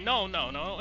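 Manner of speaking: ordinary talk, nothing marked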